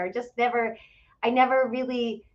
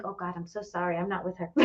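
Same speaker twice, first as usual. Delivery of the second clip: softer, embarrassed aside to the bartender; quick mortified apology to the bartender; sheepish apology; 5 semitones lower, 10 dB quieter, faster than usual. Retold or imitated. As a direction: distance yourself quietly.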